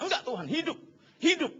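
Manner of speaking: meniru rengekan ngotot bernada tinggi; pura-pura merengek memaksa Tuhan; ngotot memohon. Acted. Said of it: "ngotot kan". (memohon ngotot dengan nada tinggi)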